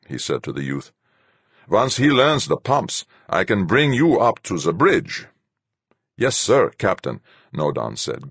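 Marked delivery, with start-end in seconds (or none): 1.7-5.3 high, loud stern commander; stern captain voice. A narrator's reading. Fuchs to Nodan. 6.2-7.2 bright, high eager reply; beaming. A narrator's reading.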